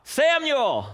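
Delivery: high, loud call of the name. A narrator's reading. The Lord calls out to the boy Samuel, a loud high call. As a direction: call out sharply to someone out of sight. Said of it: "the Lord called out".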